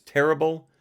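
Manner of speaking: terrible said high and loud; all caps emphasis. Shown as a demonstration. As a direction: punch the word terrible like capitals. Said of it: "all caps".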